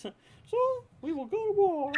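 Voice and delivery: very high, loud mock decree; hammed-up warlord calling to war; authoritative war call.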